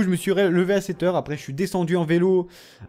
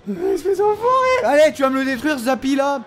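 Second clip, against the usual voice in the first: high, louder indignant yelp; mock outrage; 11 semitones higher, 4 dB louder than usual. Real, not acted. Gamer yelling at the enemy who hits him, high outrage. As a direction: squeal the insult in playful fury. Enemy destroys his unit.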